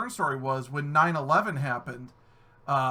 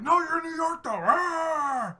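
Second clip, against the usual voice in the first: high whiny cry of protest; mock whiny protest; 10 semitones higher, slower than usual. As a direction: wail it like a stubborn friend. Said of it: "People are like".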